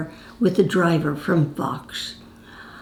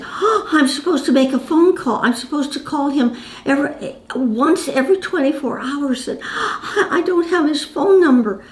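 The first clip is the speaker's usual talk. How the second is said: higher, fretful pleading that breaks into a sob at the end; imitating a distressed starlet close to crying; upset, near tears; 6 semitones higher than usual. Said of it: "suddenly she got very upset".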